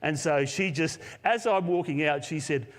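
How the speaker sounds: ordinary talk, nothing marked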